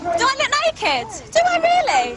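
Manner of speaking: high, rising outrage; incredulous and offended, pushing back; indignant. Real, not acted. Street argument, indignant reply.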